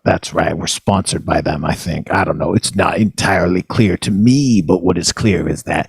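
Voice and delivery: slow, low-pitched radio host patter; radio show intro. Acted. Host welcomes listeners to the spleen's radio show, slow announcer patter.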